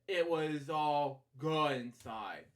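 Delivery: usual speaking voice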